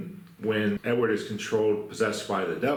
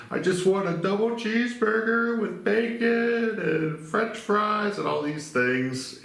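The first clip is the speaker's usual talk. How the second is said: much higher, slow, mock-tearful voice; weepy Edward imitation; 12 semitones higher, slower than usual. Retold prisoner ordering his last meal, imitating weepy sobbing. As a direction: drag it out like a crying man begging. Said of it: "he's just very weepy as his last meal".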